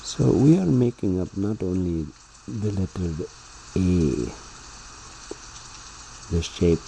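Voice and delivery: normal talking voice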